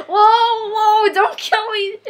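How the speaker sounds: half-laughing panicky whoa; panicked. Real, not acted. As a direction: yelp it, laughing and scared. Attacked in game.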